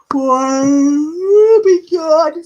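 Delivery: words stretched out through a big yawn. Real, not acted. Reader yawns mid-sentence while naming the next viewer, yawning. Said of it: "I feel really bad when I yawn".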